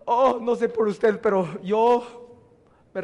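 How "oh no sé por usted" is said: ordinary talk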